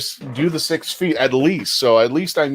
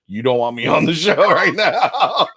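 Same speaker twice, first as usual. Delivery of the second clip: laughing retold refusal; 5 semitones higher than usual. Guest fresh from the shower refusing Joe, laughing helplessly. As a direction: protest breaking up into laughter.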